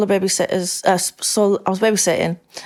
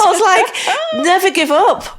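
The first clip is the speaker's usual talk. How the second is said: laughing; 12 semitones higher, 5 dB louder, slower than usual. Retold or imitated. Laughing, elated retelling.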